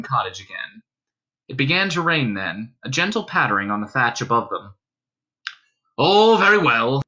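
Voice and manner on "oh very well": higher, louder, mildly testy gentleman; a trifle testy. A narrator's reading. Tall gentleman giving in to Dunstan, a trifle testily. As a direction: give in with a touch of impatience. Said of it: "said the tall gentleman a trifle testily".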